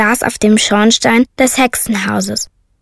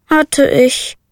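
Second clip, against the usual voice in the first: sighing; 3 semitones higher than usual. A narrator's reading. Little witch sighs to the raven about her broom, sighing. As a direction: breathe it out, resigned. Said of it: "seufzte die kleine Hexe".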